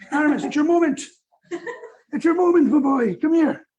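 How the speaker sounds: high, loud, excited call with a laugh; upbeat, eager call to the dog. Real, not acted. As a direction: cheer the dog on to come. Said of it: "calling the dog".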